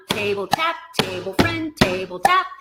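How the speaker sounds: "table tap, table friend" on the beat, lifting at the end; rhythmic call-out. Shown as a demonstration. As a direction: chant the cup moves, building to the last "table tap". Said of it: "One more time".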